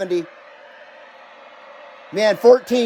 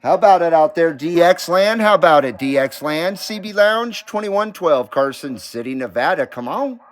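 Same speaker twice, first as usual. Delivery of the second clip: CB radio call; 4 semitones lower than usual. Real, not acted. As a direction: call out over the CB, hailing anyone listening.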